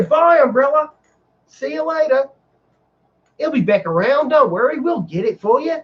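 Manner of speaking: very high, exaggerated Australian lilt; hyper Australian accent. Ride attendant reassuring a guest, hammed-up Australian accent. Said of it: "like, hyper Australian".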